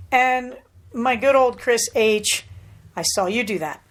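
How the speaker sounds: ordinary talk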